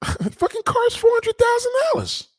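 very high, laughing squeal; mocking saleswoman cackle. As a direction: squeal the price through a laugh.

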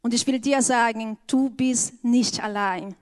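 ordinary talk, nothing marked

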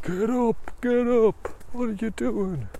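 high-pitched urgent wake-up call; high, insistent voice rousing someone from sleep; urging tiger. Acted. Tiger trying to wake sleeping runner, high and urgent. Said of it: "he's, like, saying".

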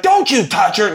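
high-pitched outraged warning; high, heated mock threat; mock angry warning. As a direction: imitate a furious woman warning him off. Retold fight, second woman threatens him.

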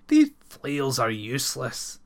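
contemptuous. Acted. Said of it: "he said contemptuously".

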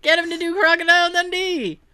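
playful insistent holler; mock excited demand. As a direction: call out a casting order with a laugh. Excited mock casting order.

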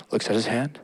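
ordinary talk

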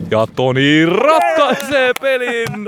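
high, slow triumphant shout; winning call. Pretend. Commentator calls Toni's match-winning basket, triumphant.

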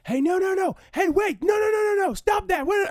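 high, loud mock yell of no no no; imitated frantic yelling. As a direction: shout it up high like a panicking stranger in the dark cinema. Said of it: "all of a sudden I hear".

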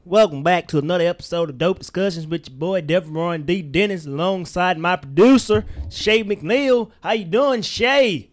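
hammed-up Mississippi accent, loud. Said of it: "this is how people from Mississippi talk".

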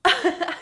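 sudden high giggle; burst of high laughter. Real, not acted. Partner laughs mid-rant.

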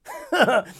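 short high falling laugh; knowing laugh. Real, not acted. After saying you can't convince somebody, high amused laugh. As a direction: let out a quick high laugh.

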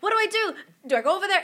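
high, loud flustered asking; frantic questions. Retold or imitated. Mother on the phone at night, asking high and frantic. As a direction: panicky rush of questions. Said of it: "And I'm like".

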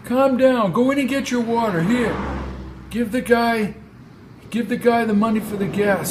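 put-on high-pitched, exasperated old man; calming older driver. Driver telling Brady to calm down, exasperated. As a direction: settle the young man down.

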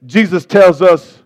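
usual speaking voice

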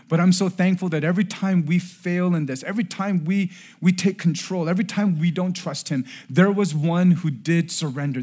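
high, loud sermon climax; impassioned preaching. Real, not acted. Preacher thanking God for the one who surrendered, fervent and loud. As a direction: preach it at full force, driving each repeated phrase.

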